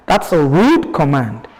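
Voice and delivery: ordinary talk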